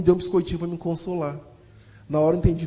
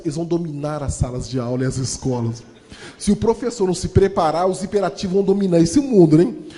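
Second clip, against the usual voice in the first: ordinary delivery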